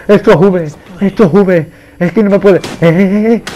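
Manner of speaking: high, loud frantic yell; panicked shouting. Real, not acted. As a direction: cry out in a flustered chase panic. Panicking while chased in the game. (gritos agudos de pánico)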